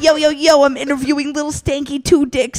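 hint of a mock pimp podcaster; Sandbuck. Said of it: "We always give him that voice".

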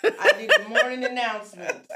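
loud laughing speech; laughing loudly. Real, not acted. Laughing retelling.

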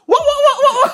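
shrill teasing yell; loud high jeering cries; high loud mocking wah. Whole class jeering.

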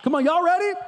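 eager call to the congregation; excited hype. Real, not acted. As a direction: fire up the room. Hyping up the congregation.

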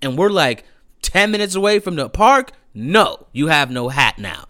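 put-on dad telling the boy off in the car; scolding dad. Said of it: "my dad's like".

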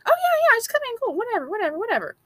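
high, dismissive mock breeziness; mocking careless. Mocking people who shrug off open borders, high and dismissive. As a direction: sneer at the carefree open-borders crowd. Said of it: "being like".

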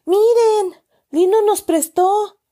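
high, loud happy burst; happy news. A narrator's reading. Chucho bringing back the borrowed money, happy and high. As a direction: bounce in with the good news. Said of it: "nos informó feliz".

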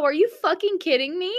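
high, loud delighted outburst; real excited exclamation; excited disbelief. Real, not acted. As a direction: shriek in gleeful disbelief.

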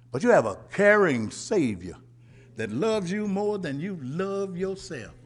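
ordinary talk, nothing marked

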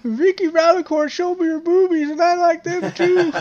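very high squeaky put-on voice; silly falsetto. Acted silly falsetto line.

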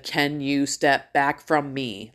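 deliberate, drawn-out request for slow listeners; slow, pointed repeat. Retold or imitated. Mom asks shoppers a second time, slowly and pointedly. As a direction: spell it out slowly, word by word. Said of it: "maybe you weren't understanding what I said the first time".

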